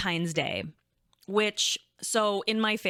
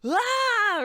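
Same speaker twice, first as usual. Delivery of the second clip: excited shriek; 5 dB louder than usual. Retold or imitated. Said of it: "screaming and, like, yelling".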